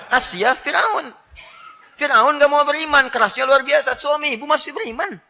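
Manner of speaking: high, loud, laughing rebuke; fiery preaching. Acted. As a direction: hammer the point home with mock outrage. Preacher rebuking the wives loudly.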